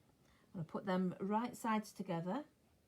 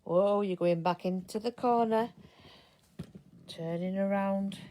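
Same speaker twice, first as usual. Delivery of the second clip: talking to herself; 2 semitones higher, 10 dB louder, slower than usual. Real, not acted. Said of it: "I do talk to myself".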